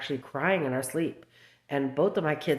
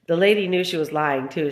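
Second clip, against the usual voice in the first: ordinary talk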